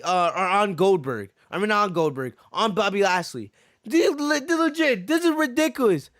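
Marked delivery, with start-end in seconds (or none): 3.8-6.1 higher, fired-up exasperation; exasperated outburst. Real, not acted. Ranting to viewers about the match, high exasperated outburst. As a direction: pitch jumps way up in disbelief.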